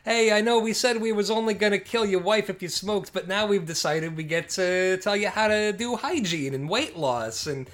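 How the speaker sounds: building mock threat of a body-policing mob; mock mobster rant. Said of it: "to be like".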